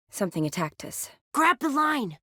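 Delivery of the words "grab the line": high-pitched shouted instruction; pressing, raised-pitch order; urgent call. Acted. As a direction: call it out across a distance. Calling out an order in danger.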